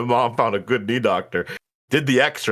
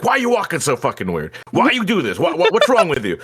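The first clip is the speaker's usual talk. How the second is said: imitating the uncle snapping at him; retold uncle yelling; 7 semitones higher than usual. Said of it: "he always yells at me".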